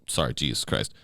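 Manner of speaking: ordinary talk, nothing marked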